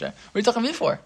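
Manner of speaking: hint of God scolding, still restrained; God rebuking Moshe.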